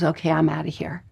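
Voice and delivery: quick, low, soft bail-out; lightly acted inner voice quitting; giving up. Teacher voices the barking dog in minute three, giving up.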